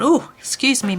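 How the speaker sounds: rough, breaking hoarse voice. Real, not acted. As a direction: voice cracking, hoarse and strained. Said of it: "my voice is gone".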